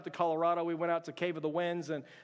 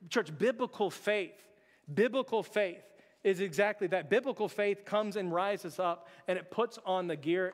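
slow, emphatic preaching build; slow down and hammer biblical faith home; 2 semitones higher than usual. Acted. Climactic sermon point.

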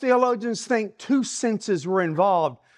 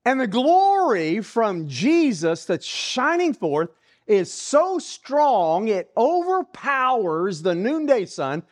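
ordinary talk, nothing marked

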